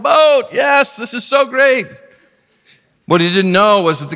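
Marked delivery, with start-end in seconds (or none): 0.0-1.9 high, drawn-out castaway holler; excited yell. Retold or imitated. Stranded man spotting a boat at last, excited yell. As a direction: whoop it out like a rescued castaway. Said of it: "got very excited, yelled".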